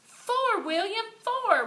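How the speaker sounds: high, loud cheer; whole class yelling the count; shouted cheer. A narrator's reading. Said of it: "we shouted".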